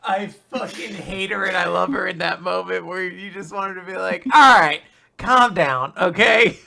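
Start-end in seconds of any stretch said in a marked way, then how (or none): 4.3-6.6 mock calm down. Wishing a character would say this.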